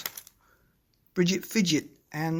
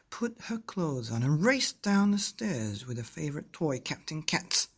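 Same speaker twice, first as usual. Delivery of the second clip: put-on lively storybook reading for kids; reading to children; 3 semitones higher, 3 dB louder than usual. A narrator's reading. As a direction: read it like a bedtime story, full of wonder. Reading picture book to children.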